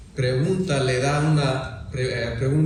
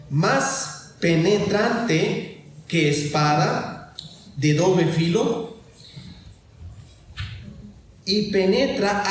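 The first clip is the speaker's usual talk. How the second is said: solemn scripture reading; 4 semitones higher than usual. Acted. Preacher reading Hebreos to the congregation, emphatic scripture reading. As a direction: read the verse out in spaced, raised phrases. Reads a Bible verse aloud.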